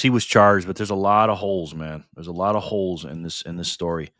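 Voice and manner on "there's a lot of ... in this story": ordinary talk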